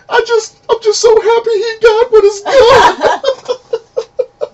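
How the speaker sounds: high, loud, drawn-out imitation weeping; mock bawling. Imitating a relative overcome by a Christmas movie, mock bawling. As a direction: wail it slowly through hammed-up happy tears. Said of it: "she just starts bawling".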